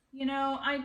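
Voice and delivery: usual speaking voice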